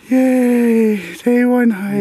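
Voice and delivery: high, loud, gleeful whoop; excited cheer. Real, not acted. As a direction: cheer it out big and bright. Cheering at the hike's start.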